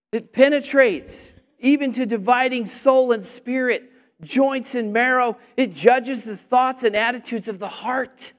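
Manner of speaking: emphatic, forceful verse reading; scripture proclamation. Real, not acted. Preacher continues Hebrews for congregation, declaiming.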